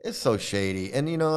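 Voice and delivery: ordinary talk, nothing marked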